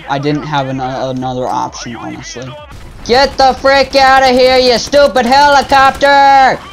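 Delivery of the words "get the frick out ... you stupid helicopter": higher, louder furious yell at the helicopter; angry shout. Real, not acted. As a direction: roar it out, fed up and furious. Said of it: "I'm getting angry, Really angry".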